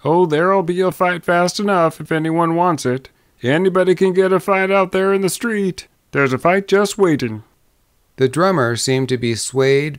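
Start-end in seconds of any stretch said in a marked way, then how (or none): 0.0-7.4 high, insistent Western townsman; warning young man. A narrator's reading. Young man who warned them, answering the drummer, insistent. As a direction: pitch it up and press the warning.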